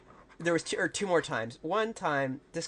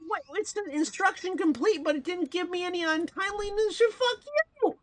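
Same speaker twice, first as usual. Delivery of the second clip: much higher, squeaky annoyed; high-pitched frustrated outburst. Real, not acted. Frustrated at game, pitch jump.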